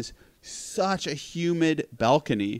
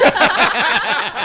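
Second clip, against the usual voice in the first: laughing hard; 6 dB louder than usual. Real, not acted. Laughing at own bit.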